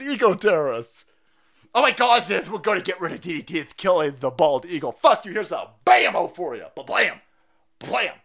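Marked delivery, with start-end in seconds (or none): none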